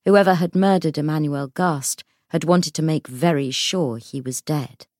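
ordinary delivery

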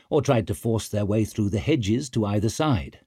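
usual speaking voice

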